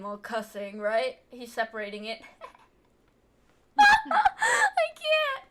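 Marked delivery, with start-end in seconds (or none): 3.8-5.4 high, loud laughter spilling into the words; can't get it out for laughing; laughing hard. Real, not acted. Viewer cracking up at the polite cussing, laughing hard.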